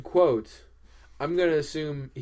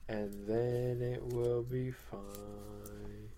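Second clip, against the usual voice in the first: slow drone trailing softer; mind-controlled trance; 7 dB quieter than usual. Pretend. Devotee tells co-host to obey the hat, mind-controlled trance. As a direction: soothe like a hypnotised cultist, slowly. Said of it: "complete and total mind control".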